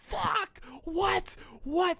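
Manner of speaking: very high, stunned squeak; imitating his own shocked gasp; mind-blown disbelief. Recalling being mind blown on the trip, high stunned. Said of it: "And just mind blown".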